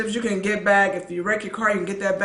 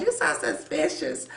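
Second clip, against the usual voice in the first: teasing whisper; 8 semitones higher, 7 dB quieter than usual. Retold or imitated. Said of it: "Pastor Hines will say sometimes".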